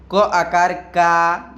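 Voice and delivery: क आकार का drawn out very slowly, every syllable separate; slow pronunciation demo. Shown as a demonstration. Teacher showing his students how क आकार का sounds, slowly. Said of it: "क का उच्चारण कुछ इस प्रकार से हो जाएगा".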